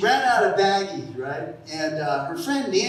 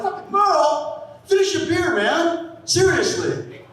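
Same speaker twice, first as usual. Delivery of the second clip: exasperated; 9 semitones higher, slower than usual. Retold or imitated. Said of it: "I'm like".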